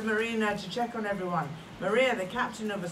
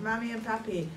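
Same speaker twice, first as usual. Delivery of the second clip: plain reading voice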